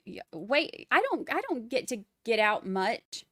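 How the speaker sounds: ordinary talk, nothing marked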